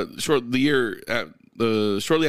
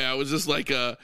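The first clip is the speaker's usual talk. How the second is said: ordinary delivery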